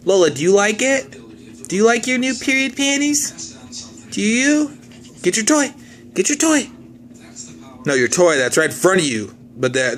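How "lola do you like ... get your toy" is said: high sing-song pet voice. Real, not acted. Talking to dog in baby voice.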